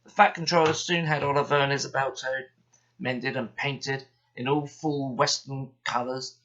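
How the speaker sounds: plain reading voice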